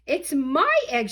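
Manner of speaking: high, loud indignant sputter. Acted. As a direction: sputter out your claim. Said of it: "she sputtered".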